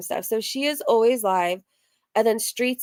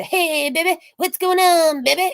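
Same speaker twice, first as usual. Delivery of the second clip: exaggerated high come-on; imitated catcaller; 8 semitones higher, 5 dB louder than usual. Catcaller to women, high mocking impression of his greeting. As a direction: ham up his obnoxious pick-up line, pitched high. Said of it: "that's what he does".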